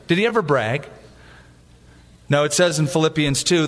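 ordinary talk, nothing marked

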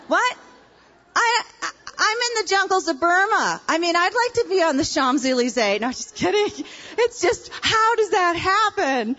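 high-pitched, comically incredulous protest; mock disbelief. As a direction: play up the jealous disbelief for laughs. Joking outrage at missionary postings.

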